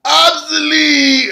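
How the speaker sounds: very high, drawn-out slow wail; Broken Matt cry. Retold or imitated. Brother Nero before the swanton, very high slow wail. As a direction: stretch the word into a theatrical howl. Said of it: "Brother Nero goes".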